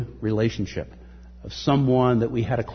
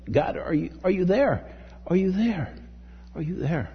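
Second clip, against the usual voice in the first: pleading upward, then fading; desperate plea; 7 semitones higher than usual. Pretend. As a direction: call out to God, then trail off. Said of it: "reaching out almost like".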